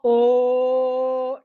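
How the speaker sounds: long, loud, projected oh; sustained "oh". Shown as a demonstration. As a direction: hold oh firmly on one note. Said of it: "Now we go".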